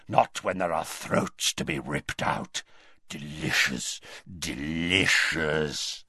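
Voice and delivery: low, rasped gloating werewolf voice; rasping. A narrator's reading. Greyback gloats over throats to be ripped out, rasping. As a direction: rasp it low, savouring each word. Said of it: "rasped Greyback".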